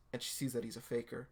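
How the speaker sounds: ordinary delivery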